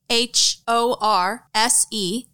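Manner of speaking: spelling it out. Shown as a demonstration. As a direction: spell each letter out clearly. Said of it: "using the discount code".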